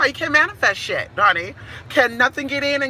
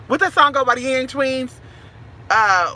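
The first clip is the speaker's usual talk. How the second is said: usual speaking voice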